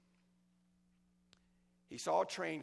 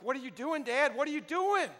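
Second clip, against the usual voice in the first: put-on bewildered boy; 9 semitones higher, 7 dB louder than usual. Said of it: "the boy's face was like".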